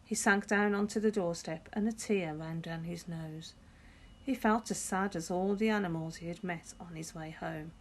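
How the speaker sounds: ordinary delivery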